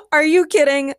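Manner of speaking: high, loud amused question; delighted disbelief. A narrator's reading. Sierra reassuring the producer, delighted. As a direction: exclaim it, fresh from laughing.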